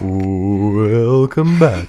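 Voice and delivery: slow, drawn-out announcer welcome; hammed-up slow DJ greeting; mock radio-host welcome. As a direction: draw it out, playing along with the tease. DJ echoes his teased intro.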